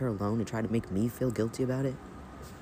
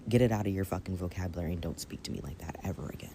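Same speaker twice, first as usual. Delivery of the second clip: ordinary talk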